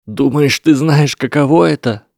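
fighting back sobs as it breaks out; trembling voice. A narrator's reading. Lesath answers Neville, voice trembling. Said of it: "Голос Лесата дрожал".

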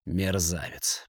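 low, soft and slow rasp; hoarse wheeze. A narrator's reading. The banker wheezes an insult at Kasimov, low and slow. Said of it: "прохрипел Мясников".

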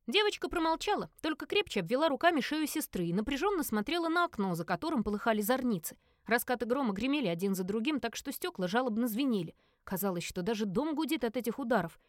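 usual speaking voice

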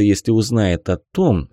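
ordinary delivery, nothing marked

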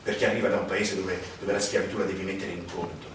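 low, brooding voice; dark storyteller. Acted. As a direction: let the words sink heavy and low.

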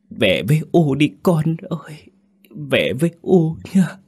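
high keening plea that cracks apart; voice breaking. Acted. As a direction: beg the lost son home, breaking into breathy sobs. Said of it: "Giọng bà vỡ ra".

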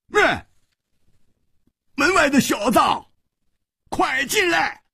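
high, commanding shout; sharp shouted challenge; great bellow. A narrator's reading. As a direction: holler to someone outside. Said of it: "大喝道".

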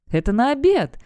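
loud scolding cry; shout. A narrator's reading. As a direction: yell it at him. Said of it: "кричит Грета".

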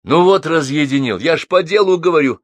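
high, loud furious outburst; enraged. A narrator's reading. Buzykin raging at the neighbour who cut the call. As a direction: snap at him, furious. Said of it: "рассвирепел Бузыкин".

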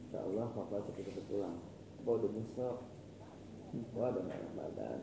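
soft, low, tender murmur into the phone; quiet phone call. Real, not acted. Father quietly reassures his daughter on the phone, soft and tender.

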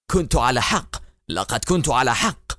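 plain reading voice

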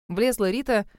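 ordinary delivery

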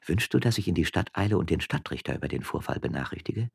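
ordinary delivery, nothing marked

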